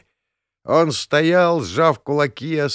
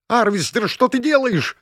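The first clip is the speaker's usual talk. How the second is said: high piercing shriek, much higher; 11 semitones higher than usual. Acted. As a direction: shriek it out in alarm. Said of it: "завизжала Зелиса".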